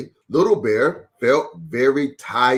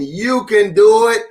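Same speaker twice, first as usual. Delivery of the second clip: encouraging cheer; 7 semitones higher, 5 dB louder than usual. Retold or imitated. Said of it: "Daddy Bear was right there saying".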